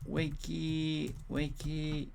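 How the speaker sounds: slow, stretched half-hearted call; drawn-out composing aloud. Pretend. Host writing a poll option aloud, slow and drawn out. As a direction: draw each word out as if typing it.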